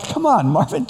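high-pitched, pleading mock exasperation; retold whiny protest; exasperated plea. Retold plea after the huge order.

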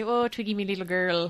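une touche d'accent irlandais, à peine marqué; Irish accent. Shown as a demonstration. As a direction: glisse un léger accent irlandais. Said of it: "I sometimes I hit a Twiggy".

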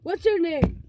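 ordinary delivery

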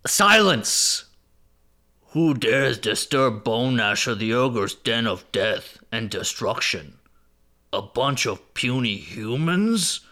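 slow, booming ogre voice; a big ogre snarling at puny humans. Acted. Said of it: "do it up".

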